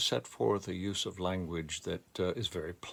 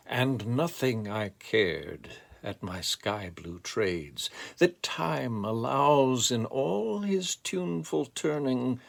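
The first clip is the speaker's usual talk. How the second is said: rising, swelling lyrical recital with sweeping pitch; poem reading; 4 semitones higher, 6 dB louder than usual. Acted. Reader sharing his favorite Dylan Thomas poem, lyrical recital. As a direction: read it like a hymn to lost childhood, building to loud peaks. Reads Fern Hill aloud.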